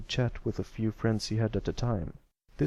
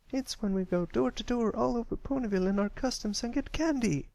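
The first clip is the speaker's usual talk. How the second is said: high filly voice, friendly and chatty; a farm filly keeping a colt company; young country filly; 13 semitones higher than usual. Acted. Apple Bloom walking alongside Pipsqueak, high friendly filly voice.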